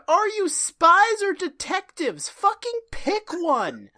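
loud, high, slow comic demand; hammed-up fed-up scolding; exasperated mock yell. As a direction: berate them, drawing out each word. Mirror Genie berating Charlie's Angels.

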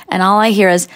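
ordinary talk

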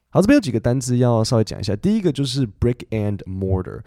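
ordinary delivery

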